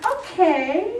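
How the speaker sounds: a chirpy put-on agreeable okay; Stepford wife voice. Mother answering Vin's change of plans, high fake-calm okay. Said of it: "I always talk in a Stepford wife voice".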